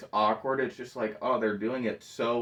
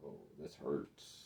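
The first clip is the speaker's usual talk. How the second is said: pained cringe; 8 semitones lower, 19 dB quieter than usual. Retold or imitated. Viewer watching the awkward FBI meeting, quietly pained.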